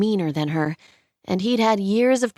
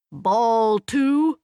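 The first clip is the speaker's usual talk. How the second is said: rising playful call of ball two; mock questioning call; 4 semitones higher than usual. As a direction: tease it as a question. Umpire calls ball mockingly.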